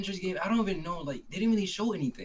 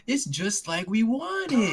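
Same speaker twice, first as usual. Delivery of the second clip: SpongeBob voice; 8 semitones higher, 5 dB louder, much slower than usual. Retold or imitated.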